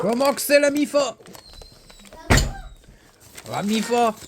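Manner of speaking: high, loud, hammed-up hello; excited loud greeting. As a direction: call out to the family with big energy. (salut tonitruant et surexcité)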